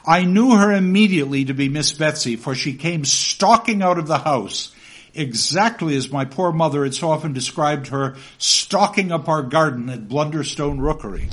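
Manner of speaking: reading the novel aloud to the class; story narration. Acted. Lecturer reading Copperfield to students, lively narration.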